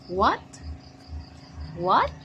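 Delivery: What? with a sharp high rise; rising question. Shown as a demonstration. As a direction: ask What? loud and rising. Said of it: "teacher models question intonation".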